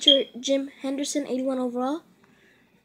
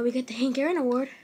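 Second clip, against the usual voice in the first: ordinary delivery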